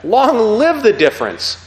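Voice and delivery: slow, loud triumphant cry; crowd shout. Retold or imitated. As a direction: call it out like a cheering assembly. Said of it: "they all screamed out".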